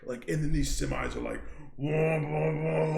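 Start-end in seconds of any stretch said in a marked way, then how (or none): 1.8-3.0 higher-pitched whooshing "wah, wah"; imitated passing trucks. Describing semis passing the stopped car, imitated whoosh noises. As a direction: mimic semis roaring by in waves.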